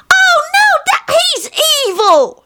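put-on very high child squeal; alarmed little son. Son interrupting dad's story, alarmed high child voice. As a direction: cry out like a shocked small boy.

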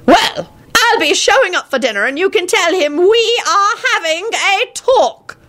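high, indignant warning; high-pitched threat, slowing at the end; threatening old man. Acted. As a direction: warn them like an irate grandfather. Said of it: "John replied threateningly".

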